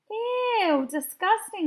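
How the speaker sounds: grossed out. A narrator's reading. Kids react together.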